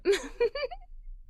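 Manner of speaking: bright little laugh after the fall; giggle. Acted. Narrator laughing at mother's fall. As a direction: laugh along with the family.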